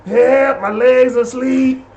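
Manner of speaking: high, drawn-out plea for help; mock cry for help. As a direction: crawl along calling out in mock distress. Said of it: "crawling on the ground like".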